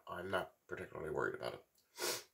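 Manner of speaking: ordinary talk, nothing marked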